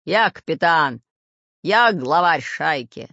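loud, slow boasting growl; forceful, drawn-out pirate roar. A narrator's reading. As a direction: assert command as a pirate boss. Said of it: "рычал Буль-Буль".

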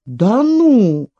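drawn-out mock amazement; feigned surprise. A narrator's reading. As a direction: pretend to be amazed, rising and falling. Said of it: "притворно удивился хозяин избы". (притворное удивление)

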